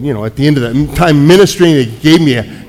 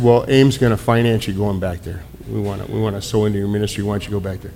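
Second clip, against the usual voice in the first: gentle urging; 7 semitones lower, 9 dB quieter, faster than usual. Retold or imitated. Terry urging him to return.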